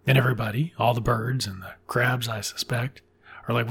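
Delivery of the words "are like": ordinary delivery, nothing marked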